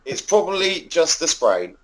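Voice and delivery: mock Welsh nurse, high and slow; exaggerated Welsh lilt; Welsh accent. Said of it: "that's my Welsh accent".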